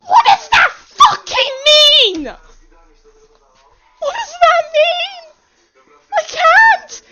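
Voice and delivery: very high, loud panicked cry; scared shrieking. Real, not acted. Player scared by the game's line, shrieking panic. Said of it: "I am so scared".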